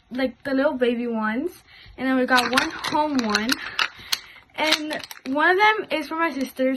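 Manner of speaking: ordinary talk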